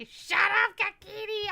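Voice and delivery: high, loud yell at the cat; loud mock yell from a bad day; imitating his yell. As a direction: bark the cat's name out loud. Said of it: "he'll fucking say".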